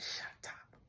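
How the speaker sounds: hushed, whispered amazement; awed whisper. Retold or imitated. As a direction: whisper it as a stunned realization. Awed reaction to repeated word.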